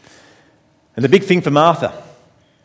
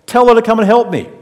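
raised, higher angry plea; furious complaint; 7 semitones higher, 6 dB louder than usual. Acted. Martha bursting out of the kitchen at Jesus, furious. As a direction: storm in and demand help. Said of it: "She's furious".

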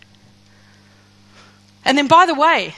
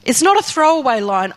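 ordinary talk

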